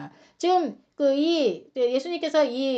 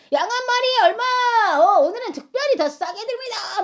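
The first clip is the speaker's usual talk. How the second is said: high, loud hawking; market vendor's cry; 10 semitones higher, 5 dB louder than usual. Acted. Merchants selling sheep in the temple, loud hawking. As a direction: call out a bargain over a busy market. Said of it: "상인들이 큰 소리로".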